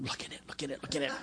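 very soft, urgent imitated thought; nagging inner voice. Preacher's mind tempting him during worship, nagging whisper. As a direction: whisper it insistently, on repeat. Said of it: "My mind's going".

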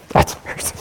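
whispered, half-asleep reply; groggy whisper. Retold or imitated. Nudged awake in a prayer meeting, sleepy whisper. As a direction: breathe it out like you just woke up. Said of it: "You're like".

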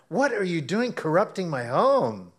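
hammed-up scold at the cat; mock scolding.

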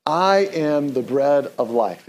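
slow, measured, every word placed; slow unison recital. Shown as a demonstration. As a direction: recite it slowly with the congregation. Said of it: "Let's say that out loud together".

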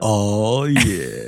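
low, seductive "oh yeah"; sultry voice. Acted. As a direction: purr it out like a crooner. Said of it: "the deep, sultry voice needs to come back".

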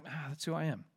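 voicing someone quietly giving in; resigned. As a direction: give up with a small "ah". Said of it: "why do we resign with thoughts".

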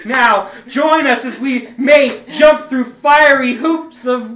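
slow, loud showman shout; circus ringmaster. Acted. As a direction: announce the big stunt. Ringmaster announcing the act.

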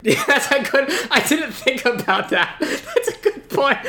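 high-pitched laughing agreement; cracking up, voice riding up high; laughing while talking. Real, not acted. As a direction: talk through real laughter. Cracks up at co-host's point.

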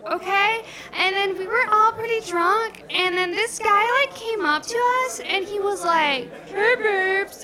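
put-on very high-pitched girly stand-up voice; first-time comic in a high, put-on voice; gushing amateur comedian.

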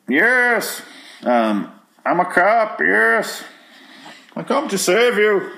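high, slow, hammed-up villain voice; Bane. A cop comes to save you, in Bane voice. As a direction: play a cop as a parody movie villain. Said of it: "it came out as Bane".

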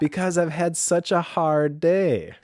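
high, mock self-pitying justification; entitled self-pity. Host voicing the victim mentality, playfully entitled. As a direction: plead that you have earned a treat. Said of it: "The passive voice".

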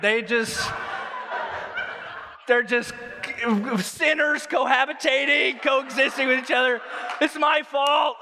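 high, slow hammed-up panic; mock horror at his own mistake; panicked inner voice. Pastor panicking over unsent marriage papers, mock horror. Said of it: "So I'm like".

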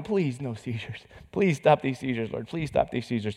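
hushed, begging prayer; a hushed plea to God; soft pleading prayer. Retold or imitated. Father quietly begging God for mercy, hushed.

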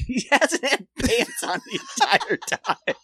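words breaking into high laughter; cracking up mid-sentence; laughing through punchline. Real, not acted.

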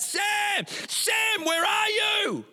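high, loud, desperate shouting of a name; frantic shout. Retold or imitated. Parent shouting for the missing son at night, frantic and loud. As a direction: yell for the missing child, stretched and slow. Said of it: "We're calling out".

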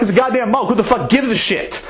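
high, scornful brush-off; dismissive scoff. Retold or imitated. Drunk Dave brushing off Jen about the mug, high and scornful. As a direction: sneer that nobody cares.

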